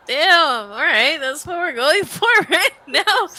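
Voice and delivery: high, loud retold reaction breaking into laughter; mock astonishment. Viewer reacting to the show's moral, mock astonishment. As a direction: exclaim it in playful disbelief.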